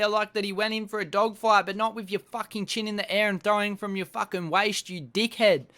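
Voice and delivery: usual speaking voice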